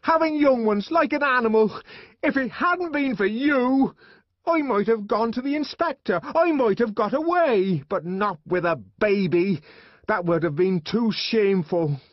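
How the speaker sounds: high, loud bitter ranting; angry shouted tirade. A narrator's reading. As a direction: rant furiously, pitch easing down toward the end. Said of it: "she shouted".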